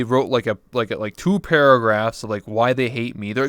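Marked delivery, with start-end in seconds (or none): none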